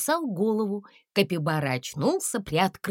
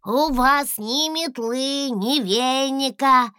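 high, slow, businesslike little dragon; a small dragon asking matter-of-factly; 7 semitones higher, 5 dB louder, slower than usual. A narrator's reading. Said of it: "деловито спросил Гусли".

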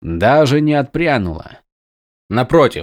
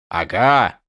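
soldier agreeing; 3 semitones lower than usual. Acted. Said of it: "подтвердил Дрехи".

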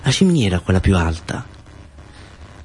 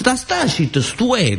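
much higher, slow squeaky jealous jab; jealous Nunzio; much slower than usual. Acted. Nunzio jealous of Mustazza at the net, squeaky high whine. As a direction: whine it out of envy. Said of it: "diceva Nunzio, un poco gelosa".

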